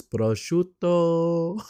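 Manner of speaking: high, drawn-out Italian prosciutto; put-on Italian accent. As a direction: burst into mock Italian for one word. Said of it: "she'd be saying everything normal".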